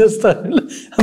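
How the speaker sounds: chuckling through the words; laughing. Real, not acted. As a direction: laugh it off while talking. Laughs at the pig card.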